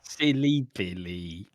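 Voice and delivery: low, slow silly voice; mock scolding. Co-host teasing the other's muddle, mock scolding. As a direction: tease it out low and drawn-out.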